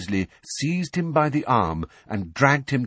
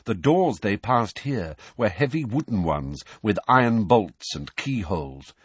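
ordinary delivery, nothing marked

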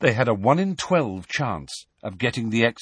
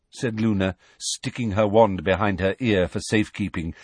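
ordinary delivery

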